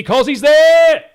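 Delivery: high, drawn-out excited yell; excited shout. Real, not acted. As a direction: roar it as the goal opens up. Goal chance called live.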